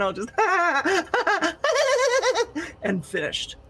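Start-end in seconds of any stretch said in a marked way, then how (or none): 0.3-2.8 high, helpless giggling laugh; demonstrated giggle fit. Shown as a demonstration. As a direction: act out a long giggling fit. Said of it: "I am just gonna be giggling".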